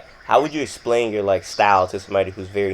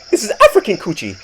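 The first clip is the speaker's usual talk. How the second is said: imitating an African dad with an African accent, higher and louder; mock African accent, pitched up and laughing; 10 semitones higher, 4 dB louder than usual. Quoting friend's dad at dinner, mock African accent. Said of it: "strong African accents".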